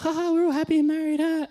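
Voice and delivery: high, giddy imitation; mock happy cheer. Wedding party in the car, mock giddy.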